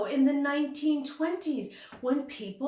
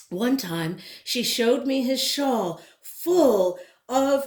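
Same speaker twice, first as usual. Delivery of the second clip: louder, lively storybook reading; reading to children; 7 dB louder than usual. Acted. Teacher reading a picture book aloud to her class, lively storytelling. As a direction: read it out with rhythm and life for the kids.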